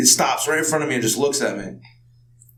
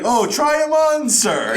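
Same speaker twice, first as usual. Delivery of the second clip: high, drawn-out put-on offer; hammed-up, slow and high invitation; mock politeness; 12 semitones higher, slower than usual. Shoe owner offers stranger a fitting, mock politeness. Imagining stranger wanting shoes tried on.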